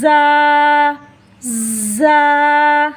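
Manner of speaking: जा said with a sharp, buzzing z; sharp letter. Shown as a demonstration. Teacher repeats the sharp letter for students. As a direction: give जा a sharp whistling z. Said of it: "तेज़ आवाज़ के साथ इस तरह".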